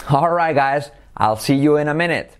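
usual speaking voice

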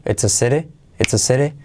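"it's a city" linked as "etsa sere"; joined up. Shown as a demonstration. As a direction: run "it's a city" into one piece. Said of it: "todo junto". ("it's a city" todo junto)

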